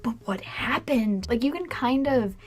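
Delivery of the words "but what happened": whispering. Retold or imitated. Confused viewer at movie end.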